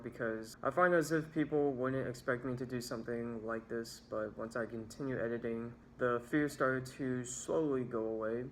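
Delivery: usual speaking voice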